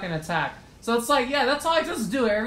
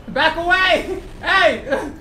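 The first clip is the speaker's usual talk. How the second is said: raised, drawn-out warning shout; loud, higher-pitched warning; alarmed shout; 6 semitones higher, 5 dB louder, slower than usual. Real, not acted. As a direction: shout to drive an attacker off. Warding off a game opponent.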